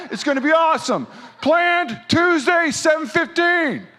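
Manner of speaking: high, loud, hammed-up event announcer; planning revival voice. Preacher mocks scheduling a revival to his church, planning revival voice. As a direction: put on a high, stretched, over-hyped voice. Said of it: "that's my planning revival voice".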